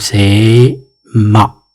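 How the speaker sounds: c'est ma drawn out very slowly; slow model pronunciation. Shown as a demonstration. Teacher stretches ma slowly while pointing at the board. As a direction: stretch ma out long for learners. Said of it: "regardez bien".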